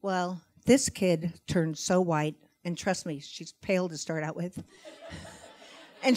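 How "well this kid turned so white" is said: usual speaking voice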